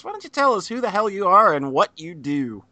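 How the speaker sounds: ordinary talk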